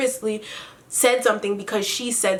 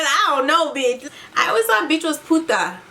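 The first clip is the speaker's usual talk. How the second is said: usual speaking voice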